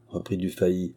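usual speaking voice

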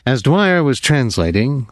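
usual speaking voice

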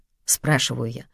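ordinary delivery

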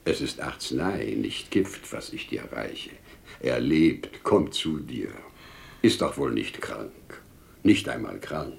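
low, soft, soothing murmur; tender reassurance. Acted. Father revives his stricken daughter, tender reassurance. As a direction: gently bring her back round.

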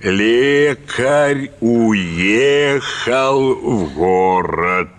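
"Лекарь уехал в город" spelled out very slowly, word by word; slow dictation. Retold or imitated. Teacher dictating to the pupil, very slow and deliberate. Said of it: "и диктует".

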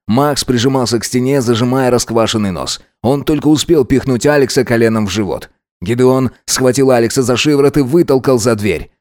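usual speaking voice

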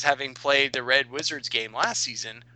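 usual speaking voice

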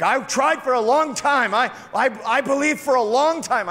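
a tired believer protests; weary pleading. Retold or imitated. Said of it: "And you're like".

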